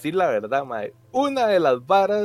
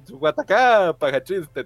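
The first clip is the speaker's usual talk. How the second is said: ordinary delivery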